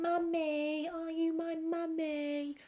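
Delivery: put-on high, childlike eerie plea; high creepy child asking for mummy; creepy kid voice. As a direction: ask like a lost ghost child. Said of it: "the kid keeps on going".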